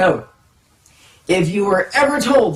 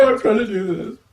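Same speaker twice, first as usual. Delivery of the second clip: hammed-up fake crying; forced tears; 3 semitones higher than usual. Shown as a demonstration. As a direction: drum up more tears, badly faked. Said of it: "trying to drum up even more tears".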